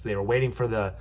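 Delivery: ordinary talk, nothing marked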